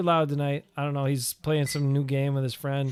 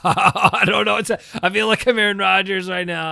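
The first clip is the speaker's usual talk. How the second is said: real laughter breaking through, higher and louder; laughing; 4 semitones higher, 6 dB louder than usual. Real, not acted. Co-host tripped out by the game board, laughing through the words. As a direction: talk while cracking up.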